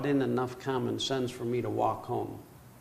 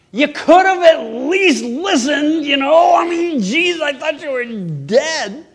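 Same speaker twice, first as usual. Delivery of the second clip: high, much louder furious yell; angry shout; 16 dB louder than usual. Retold or imitated. Father yelling at wife and daughter as they walk in. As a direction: blow up at them in spite of yourself. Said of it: "They both immediately".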